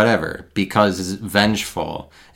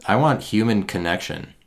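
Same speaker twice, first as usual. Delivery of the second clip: ordinary talk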